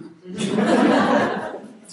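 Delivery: a loud high burst of real laughter; laughing. Real, not acted. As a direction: laugh out at your own accent attempt. Laughs at own Geordie attempt.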